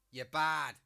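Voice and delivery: lightly voiced barman, said loud; joke barman. Barman to the crab in a Scouse joke, loud. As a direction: give the barman a loud, punchy line.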